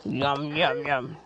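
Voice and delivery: low, soft gobbling voice; deep monster voice. Pretend. Toy eating noises.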